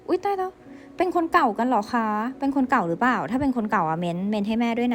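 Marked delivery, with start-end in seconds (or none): 0.1-0.5 high squeal of pretend surprise; a playful oh-my-gosh gasp, pitched high; mock shock. Tarot reader teasing viewers about an ex, high mock gasp. Gasps at ex-lover card message.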